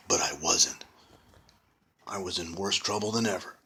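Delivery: usual speaking voice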